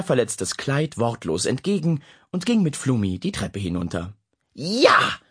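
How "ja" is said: cheer. Acted. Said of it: "brüllte Dennis".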